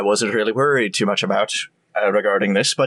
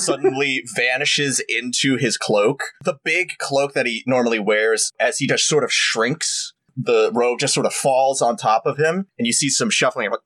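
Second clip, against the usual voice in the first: ordinary talk, nothing marked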